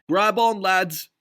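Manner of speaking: Ducky calling to the sailors. A narrator's reading. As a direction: call the lads to the board. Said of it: "he called".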